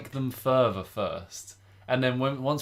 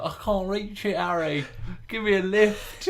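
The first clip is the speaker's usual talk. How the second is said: higher, slower voice of a workman stuck up the tower; whiny workman; 8 semitones higher, slower than usual. Retold or imitated. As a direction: ask Harry for a lift, helplessly.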